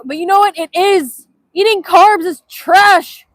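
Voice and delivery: loud, high, emphatic declaration; hammed-up rant. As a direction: proclaim it like carnivore propaganda. Mock rant for carnivore diet.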